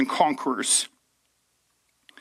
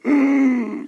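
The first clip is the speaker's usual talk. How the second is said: waking groan; 11 semitones higher, 4 dB louder than usual. Retold or imitated. Jesus waking up.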